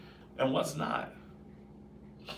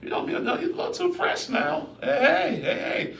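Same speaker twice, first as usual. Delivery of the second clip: fresh stranger confronted. Pretend.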